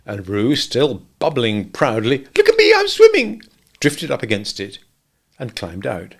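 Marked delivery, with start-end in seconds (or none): 2.4-3.4 very high, loud squeak; squeaking Roo. A narrator's reading. Little Roo calling out from the pool, squeaking. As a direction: squeak it like a tiny excited kangaroo.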